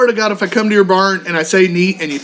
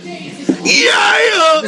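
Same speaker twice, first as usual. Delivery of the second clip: mock shouted yeah; shouted back; 11 semitones higher than usual. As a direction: yell it right back at him. Umpire shouting back at coach.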